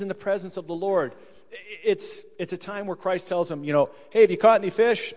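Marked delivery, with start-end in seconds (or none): 4.1-5.1 raised, casual call to someone. Acted. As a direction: call over to the fishermen. Christ calls out to fisherman.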